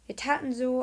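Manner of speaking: plain reading voice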